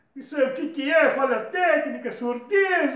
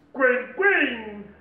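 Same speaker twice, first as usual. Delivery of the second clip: penguin. Acted. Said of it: "Repórter Atos".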